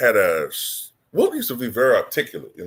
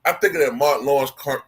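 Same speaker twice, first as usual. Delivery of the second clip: usual speaking voice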